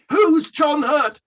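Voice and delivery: slow, high and loud cry of confusion; baffled shout. Retold or imitated. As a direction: stretch it out, high and loud, totally lost. Baffled on air at Live Aid.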